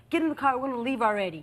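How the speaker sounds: normal talking voice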